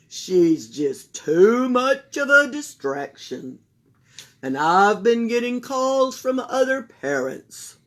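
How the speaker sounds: loud, slow, formal principal. Acted. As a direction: apologise officially. Principal phones mother.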